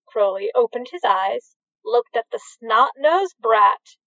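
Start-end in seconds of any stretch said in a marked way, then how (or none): none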